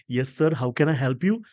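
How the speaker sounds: lightly voiced hostess asking to help; air hostess. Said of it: "पुन्हा ती येते". (नम्र हवाई सुंदरीचा हलका अभिनय)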